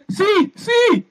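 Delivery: high frantic yelp from inside; high panicked shout. Acted. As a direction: squeal it back in a panic. Muffled reply from inside.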